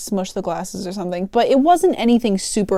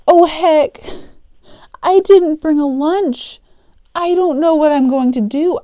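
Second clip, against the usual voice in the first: louder, higher worried outburst; mock panic; 5 semitones higher, 5 dB louder than usual. Cashier realizing the shift is longer, mock panic. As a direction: play up sudden dismay. Acted dismay at schedule mistake.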